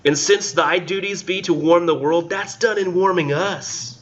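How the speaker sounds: high, slow, theatrical poetry recital; lofty slow recital, pitched high; weird dramatic reading voice. Acted. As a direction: declaim it like an old stage actor. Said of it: "read this with this weird voice".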